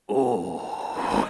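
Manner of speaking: put-on a deep, strained old-man "Oh."; a quick hammed-up Mister Miyagi grunt. Teen mocking drive-thru customers, hammed-up deep grunt. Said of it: "That's the whole impression".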